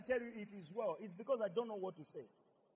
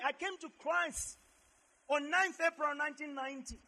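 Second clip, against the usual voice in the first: raised, higher voice crying out to God; impassioned address to God; 5 semitones higher, 9 dB louder than usual. Retold or imitated. Preacher recalls his thoughts to God, impassioned. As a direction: retell a fervent prayer at preaching volume.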